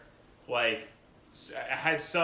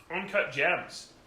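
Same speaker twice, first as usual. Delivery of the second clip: ordinary talk, nothing marked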